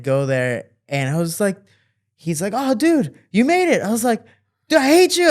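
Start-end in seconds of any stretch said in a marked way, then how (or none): 2.5-3.8 high, loud mock delight; pitched-up exclaiming welcome; excited greeting. Friend greets him after his beating. 4.7-5.3 very high, loud exasperated outburst; hammed-up high-pitched anger; mock fury. Angry at friend, acts strangling him.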